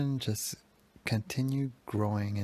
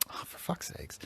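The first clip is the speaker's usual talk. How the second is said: exasperated mutter under the breath; frustrated; 8 semitones higher, 9 dB quieter than usual. Retold or imitated. Son fed up with his mom, frustrated mutter. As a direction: huff it out softly, almost whispered. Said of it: "because of my frustration and my anger".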